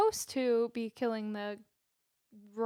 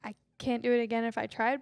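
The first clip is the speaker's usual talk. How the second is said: usual speaking voice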